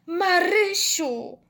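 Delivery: high, drawn-out moan of horror; horrified moan.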